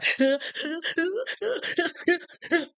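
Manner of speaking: high wailing mock child's cry, half laughing; imitated kid cry. Imitating a child's ugly cry for the viewers. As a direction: wail like a little kid then crack up. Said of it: "how we remember the kids cry".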